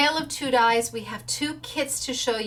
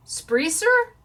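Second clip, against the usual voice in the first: much higher doubtful rise on the name; unsure questioning; 10 semitones higher than usual. Real, not acted. Unsure of surname pronunciation.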